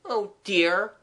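put-on high, dismayed child's sigh; disconsolate. Child dismayed before the artist, disconsolate. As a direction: lament it in a child's voice. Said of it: "I cried disconsolately".

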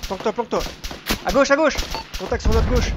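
loud, high alarmed calls; urgent shouting. Real, not acted. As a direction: yell a warning under fire. Contact, urging teammates to hide.

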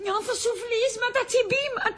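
very high, feeble singsong whine; feigned heart pains. Pretend. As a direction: complain like a mock dying patient. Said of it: "Έχω ανάγκη από μια καρδιακή κρίση".

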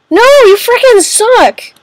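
high, drawn-out protest; frustrated outburst. Real, not acted. Player protests at a bad game outcome, frustrated. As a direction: whine it high and slow at the game. Game character takes the money.

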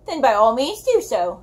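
ordinary delivery, nothing marked

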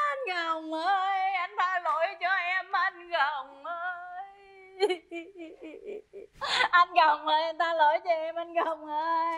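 keen it out in long drawn notes; wailing apology. Acted. Wife begging dead husband's forgiveness, wailing.

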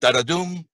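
da-da-doom, the final doom stressed; bouncy galloping verse. Shown as a demonstration. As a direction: chant da-da-doom with weight on doom. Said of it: "All right, so that's".

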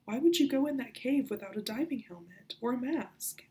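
furious at him for risking his life; angry outburst. Acted. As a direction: confront him in rising anger. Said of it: "Anger flamed in her chest".

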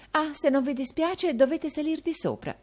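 with a smile. Acted. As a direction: answer friendly with a smile in the voice. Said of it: "con un sorriso".

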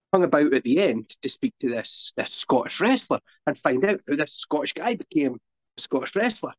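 ordinary talk

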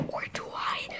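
hushed, teasing aside; mock-ominous whisper. Vlogger doubts own disbelief in superstition, mock-ominous whisper.

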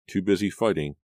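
usual speaking voice

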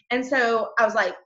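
ordinary talk

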